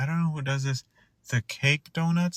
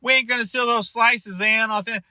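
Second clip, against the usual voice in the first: higher-pitched mock Brooklyn vendor; put-on Brooklynite voice, raised pitch; imitated Brooklyn seller; 7 semitones higher than usual. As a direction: play an indignant Brooklyn counter worker, pitched up. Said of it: "in Brooklyn they're like".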